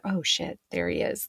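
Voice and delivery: hushed. Retold or imitated. Therapist spots ex-client in store.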